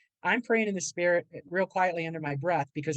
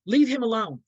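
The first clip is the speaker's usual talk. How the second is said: louder, higher, sharp order; angry snap; 5 semitones higher, 7 dB louder than usual. Retold or imitated. Mother ordering her son away from his father, loud and angry. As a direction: bite the words out at him.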